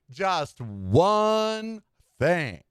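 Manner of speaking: "Just one thing" drawn out very slowly, each word weighted; slow and deliberate. Acted. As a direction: stretch "one" out long for suspense. Dramatic slow repeat of teaser.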